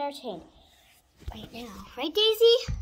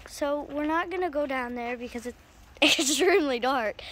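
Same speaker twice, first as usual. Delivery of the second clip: ordinary talk, nothing marked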